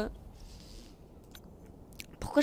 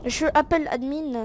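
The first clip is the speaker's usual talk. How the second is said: normal talking voice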